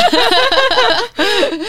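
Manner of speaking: loud falling laugh; high laugh. Real, not acted. Laughs at jealousy joke.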